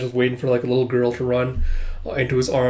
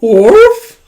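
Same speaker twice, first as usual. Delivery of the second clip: high, very loud woof rising; questioning dog bark; 9 semitones higher, 13 dB louder than usual. Retold or imitated. Dog looking back at its owner, questioning bark.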